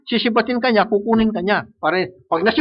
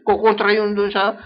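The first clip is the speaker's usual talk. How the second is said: normal talking voice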